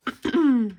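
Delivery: loud throat clear. Real, not acted. Sick, clearing throat mid-count.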